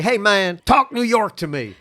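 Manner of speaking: high, loud mock request; mimicked eager fan. People pestering a New Yorker to talk, mimicked loudly. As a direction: imitate an excitable stranger egging someone on.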